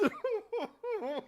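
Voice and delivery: high, trailing howl of laughter; helpless hooting laugh; real laughing fit. Real, not acted. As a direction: laugh yourself nearly to tears. Cracking up at own joke.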